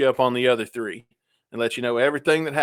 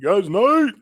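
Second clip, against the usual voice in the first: band name called out high and loud; hyped announcer; 8 semitones higher, 5 dB louder than usual. Shown as a demonstration. Echoing band name, hammed up.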